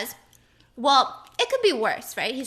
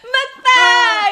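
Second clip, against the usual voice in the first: shouted announcement; 10 semitones higher, 12 dB louder than usual. Pretend. Announcing the show.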